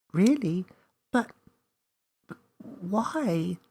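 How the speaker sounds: wide-eyed disbelief; young Millie amazed she is special; surprised little girl. Acted.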